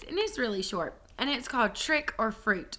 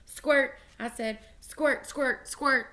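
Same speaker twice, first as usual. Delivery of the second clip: bouncy pretend squirting noises; chirpy make-believe squirts; playful squirt sounds; 5 semitones higher, 4 dB louder, much slower than usual.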